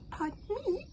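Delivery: very high, loud giggly squeals; hogfly proud of its pop; squealing. Acted. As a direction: squeal in triumph. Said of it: "squealed the hogfly".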